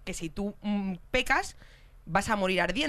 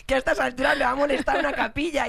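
laughing impression of Natalia; 3 semitones higher, 4 dB louder than usual. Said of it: "En plan de".

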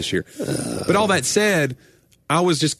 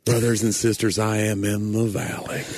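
mock preacher; 4 semitones lower than usual. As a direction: ham up a suffering preacher from the pulpit. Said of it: "make that about the sermon".

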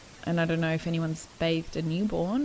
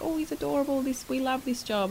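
mock gushing in a much higher pitch; excited gushing; 8 semitones higher than usual. Paramedics delighted by a lovely newborn, high excited gushing. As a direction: gush over a baby, imitating adoring fans. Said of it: "they were so excited".

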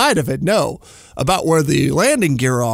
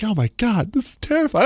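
mock terror; 7 semitones higher than usual. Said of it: "I'm like".

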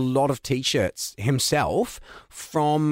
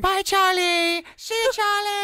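much higher, slow cartoon farewell; unicorn voice. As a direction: call goodbye high and drawn out. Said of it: "we just acted out the entire thing".

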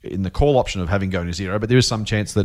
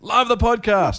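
much higher, slow, hammed-up cheer; exaggerated capitals; 13 semitones higher, much slower than usual. Shown as a demonstration. Host re-reading Kate's all-caps praise, hammed-up and high. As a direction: belt the all-caps greeting in a big put-on voice. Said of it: "that's why I said it loudly".

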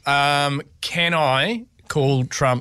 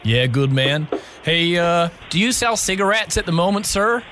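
put-on American accent. Prank caller asks clerk for cigarettes, American accent. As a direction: play a casual American caller. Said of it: "talk to them in your American accent".

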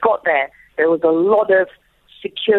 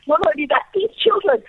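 higher-pitched mock urgency; acted frantic mode; 8 semitones higher than usual. Mother arguing with guards about the children, acted frantic plea. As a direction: plead frantically, rising high. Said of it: "I went into that frantic mode again".

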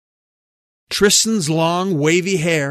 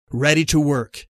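plain reading voice

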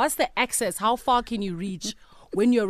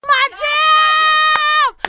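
excited shout; 11 dB louder than usual. Real, not acted. Hyped studio shout.

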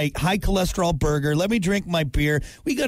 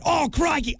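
higher jokey Australian crikey; mock Australian; 10 semitones higher than usual. Host imitating a crocodile expert, mock Australian. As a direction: toss off a cartoon Australian crikey. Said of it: "a guy ... with that".

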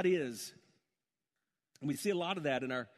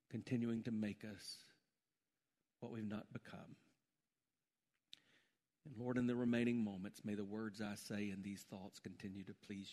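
low, soft praying with long pauses; hushed prayer; 8 semitones lower, 8 dB quieter than usual. Real, not acted. Pastor asking God to lift somebody up, hushed prayer. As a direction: pray gently and unhurriedly, voice dropped.

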